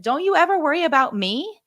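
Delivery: high, playful teasing question; making light of it. Retold or imitated. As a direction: toss it off lightly to a worried child. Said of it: "I tried to make light of it".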